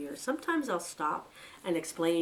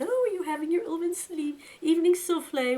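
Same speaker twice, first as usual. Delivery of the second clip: talking to a pet; 11 semitones higher, 5 dB louder than usual. Retold or imitated. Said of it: "I always ask my rabbit".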